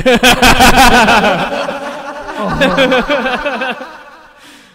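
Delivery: bursting out in a high laugh; loud high laugh. Real, not acted. Big laugh at a joke.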